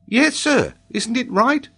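put-on high, puzzled child's voice; innocent schoolboy. A narrator's reading. Boy asks the professor if he erred, high innocent voice. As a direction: ask it in all innocence.

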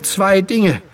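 high, winded voice; breathless boy; gasping for air. Acted. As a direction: get it out between breaths. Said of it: "während er noch nach Luft schnappte".

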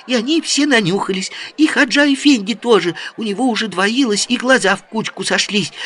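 put-on high, rapid girlish chatter. A narrator's reading. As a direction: rattle it off like an excited girl. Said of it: "быстро затараторила Джамиля".

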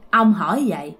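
ordinary delivery

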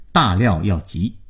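ordinary delivery, nothing marked

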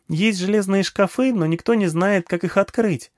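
put-on high, eager child voice; small girl eagerly addressing an old man; little girl. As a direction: pitch it up like a chatty little girl. Narrator voices little girl Nagma.

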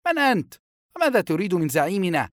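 high, loud wary questioning; young guard's suspicious demand; wary challenge. A narrator's reading. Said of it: "تأمله الفتى بحذر".

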